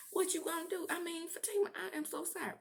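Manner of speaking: mock pleading sorry in a high voice; high apologetic voice. Retold apology in quarrel.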